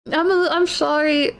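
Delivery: ordinary delivery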